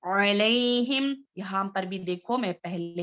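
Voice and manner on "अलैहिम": slow drawn-out अलैहिम with a clear ain sound; slow Arabic recitation of अलैहिम; Quranic recitation demo. Shown as a demonstration. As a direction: recite अलैहिम slowly, teacher modelling. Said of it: "अलैहिम में जो है".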